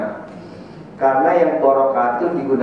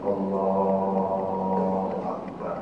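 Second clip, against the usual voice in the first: drawn-out takbir; 4 semitones lower, 9 dB quieter, much slower than usual. Shown as a demonstration. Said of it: "Gak usah lebar ya".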